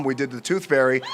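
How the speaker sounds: normal talking voice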